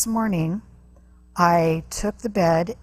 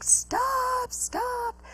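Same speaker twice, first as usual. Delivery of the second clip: much higher, pleading mom voice; imitating a panicked mother crying stop; mom's desperate plea; 7 dB quieter than usual. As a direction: beg him to stop in a high frantic voice. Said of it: "my mom's like".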